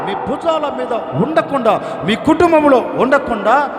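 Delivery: high, loud, driving sermon cadence; preaching at full force. Acted. Pastor preaching to the congregation about their burdens, fervent. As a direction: preach it hard to the crowd.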